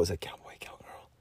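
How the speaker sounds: discreet whisper to check; whispered aside. Acted. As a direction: whisper the question to the parents.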